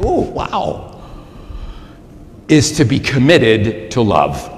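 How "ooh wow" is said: high, breathy mock "ooh, wow" from the congregation; mock amazement. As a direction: tease the listeners with a high fake gasp of wonder. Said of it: "You're like".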